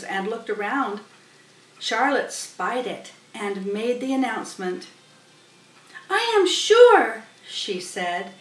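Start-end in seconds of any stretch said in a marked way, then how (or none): 6.1-7.2 high, loud and slow spider voice; Charlotte's voice. Acted. Charlotte announcing the goslings to the barn, high and grand.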